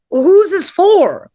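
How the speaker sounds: high, drawn-out comic wail; exaggerated overwhelmed protest; mock bewildered outcry. Acts out reaction to powerful toy.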